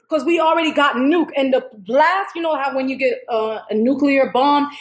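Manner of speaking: usual speaking voice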